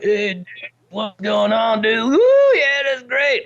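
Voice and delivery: sleepy slacker rising into a high whoop; couch potato character. Acted. Ventriloquist voicing his couch potato puppet, goofy slacker voice. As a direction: drawl as a couch potato, then burst out high and loud. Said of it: "and it's like".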